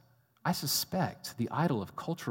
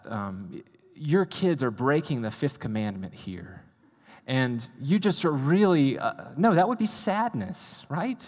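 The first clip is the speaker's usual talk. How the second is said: ordinary talk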